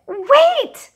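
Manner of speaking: high, loud cry of Wait. A narrator's reading. Camila calls the old woman back before she leaves, high and loud. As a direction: shout it to stop someone leaving. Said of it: "she cried".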